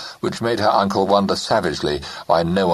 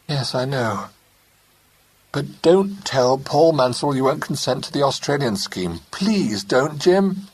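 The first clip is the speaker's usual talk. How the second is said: higher, urgent plea rising on Jim; pleading young wife; 9 semitones higher than usual. Acted. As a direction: beg him not to refuse.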